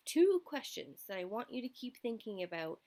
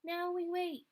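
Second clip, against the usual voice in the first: faint seed voice; 9 semitones higher than usual. Acted. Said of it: "Seed's voice called up faintly".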